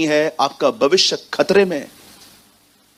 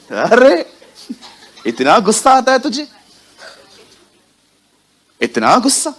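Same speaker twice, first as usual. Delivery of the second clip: mocking; 6 semitones higher than usual. Mocking an angry man.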